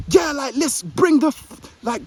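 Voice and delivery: high, loud joking shout. Shown as a demonstration. Vlogger demoing a shout in the park, loud high yell. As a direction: bellow it across the park. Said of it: "I'm gonna shout".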